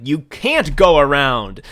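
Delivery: loud, drawn-out comic prohibition; exaggerated government scolding; mock authoritative shout. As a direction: bellow a mock ban, slowly. Voices government forbidding it.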